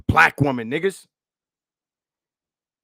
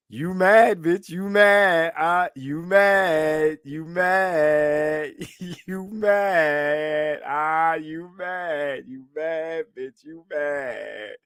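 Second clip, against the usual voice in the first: sing-song "you mad" mockery, a bit higher; taunting; 4 semitones higher, 4 dB louder, much slower than usual. Streamer mocks the angry woman, taunting sing-song. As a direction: rub it in, playground taunt.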